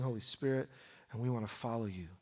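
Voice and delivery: ordinary talk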